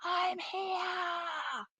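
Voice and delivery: high, distant imitated shout; small, soft far-off voice, pitched high and stretched out; calling from down a tunnel. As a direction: call out faintly from far down a tunnel. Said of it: "like, down a tunnel".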